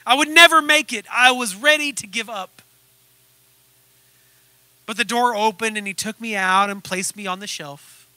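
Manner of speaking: usual speaking voice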